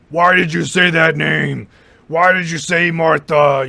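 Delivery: slow, loud, gruff demand; angry growl. Retold or imitated. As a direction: growl it like an enraged Batman. Acting out Batman's angry demand.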